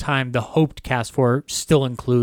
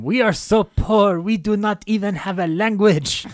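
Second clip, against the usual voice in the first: mock French accent; 7 semitones higher than usual. Madame Defarge mocking her own French accent. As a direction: ham up a thick French accent. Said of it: "Madame Defarge going".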